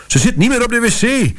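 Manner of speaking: mother bursting in with the news, shrieking; panicked shriek. Retold or imitated. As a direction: shriek it in alarm. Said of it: "gierde ze".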